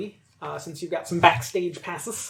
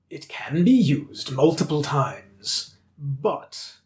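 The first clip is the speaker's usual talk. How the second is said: slow, low, whispered mask voice; hollow talking mask; 3 semitones lower, much slower than usual. Acted. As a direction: speak as an eerie floating mask. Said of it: "Do the mask voice".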